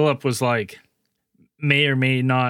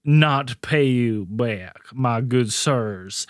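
southern belle. Pretend. King as genteel southern gentleman.

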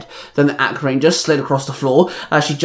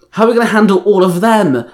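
louder, higher-pitched worried question; alarmed question; 6 semitones higher, 5 dB louder than usual. A narrator's reading. Ranger faces a crowd of minions, asking loudly. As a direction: ask it loudly over the fray.

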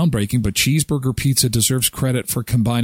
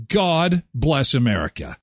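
ordinary talk, nothing marked

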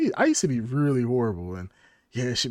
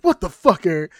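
retold angry wife; 4 semitones higher, 6 dB louder than usual. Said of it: "She's like".

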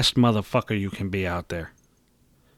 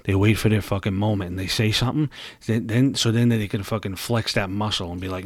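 ordinary talk, nothing marked

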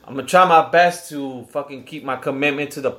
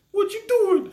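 mock friend on the phone. Friend calling, voiced high.

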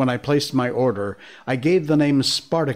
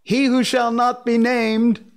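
barista calling out; 10 semitones higher, 3 dB louder than usual. Retold or imitated. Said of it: "He goes".

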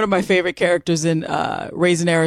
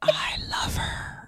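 soft breathy whisper; 11 dB quieter, slower than usual. Real, not acted. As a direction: whisper it, overcome with feeling. Moved reaction to story.

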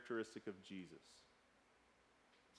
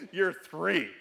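loud, raised, mock-indignant retort; exasperated disbelief; 4 semitones higher, 10 dB louder than usual. Father's inner reply to his three-year-old, exasperated.

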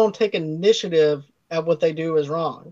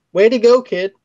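loud, higher mock praise; sarcastic congratulation; 5 semitones higher, 6 dB louder than usual. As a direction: scold him with sarcastic praise, imitating a superior. Superiors scolding him, acted out.

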